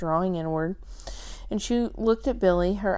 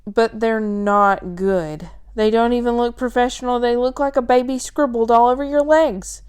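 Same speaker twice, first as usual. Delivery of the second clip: upset; 3 semitones higher, 7 dB louder than usual. Acted. Billy upset at the tattoos.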